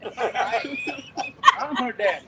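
slow, high laugh through the words; laughing tearfully. Real, not acted. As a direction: laugh while choking up. Thinking of his baby girl.

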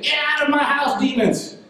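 retold excited command to demons. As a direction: call it out high, imitating an excited man. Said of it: "he got excited".

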